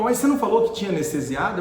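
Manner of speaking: acting out an indignant professor; retold indignant challenge. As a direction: confront the student in disbelief. Professor confronts student after patient cries.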